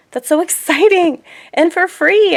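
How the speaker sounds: high, loud, gushing delight; excited. Real, not acted. Thrilled that the coworker gave masks free, gushing high and loud. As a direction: squeal it out with real delight.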